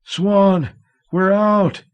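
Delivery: high, loud cry of joy; joyful shout. A narrator's reading. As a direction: shout it out, near tears. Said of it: "he shouted, about to sob with joy".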